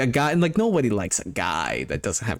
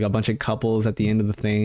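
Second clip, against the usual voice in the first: normal talking voice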